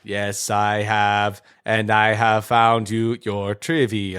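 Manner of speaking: low, slow robotic villain; robot count voice. Pretend. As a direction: drawl it out like a cyborg count. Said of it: "Cyborg Count Trivia".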